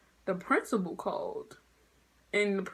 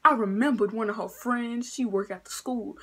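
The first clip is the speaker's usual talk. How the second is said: ordinary talk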